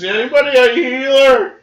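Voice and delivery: high, drawn-up far-off call; imitated distant yell. Game master echoes the player's cry for a healer, high yell. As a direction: mimic someone hollering from afar. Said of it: "you guys hear off in the distance".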